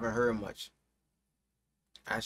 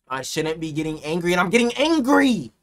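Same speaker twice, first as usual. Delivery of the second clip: building from usual voice to a loud yell; getting angry; 7 dB louder than usual. Real, not acted. Streamer worked up while watching a video, getting angrier. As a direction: let the frustration boil over into a shout.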